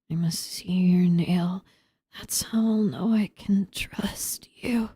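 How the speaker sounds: slow unvoiced creepy whisper; eerie voice demanding proof through a door; freaky whisper. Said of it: "I like doing freaky voices".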